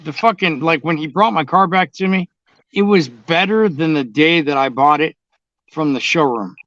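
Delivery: ordinary talk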